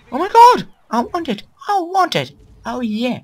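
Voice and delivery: very high, loud fanboy squeal; over-the-top high-pitched excitement; excited squealing. Real, not acted. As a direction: squeal with greedy delight. Thrilled by game item.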